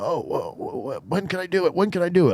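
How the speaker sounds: high, eager rush of words; mock eager contractor; super eager. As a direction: mimic a greedy, overeager guy. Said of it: "Like, super eager".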